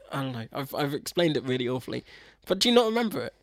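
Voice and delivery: ordinary delivery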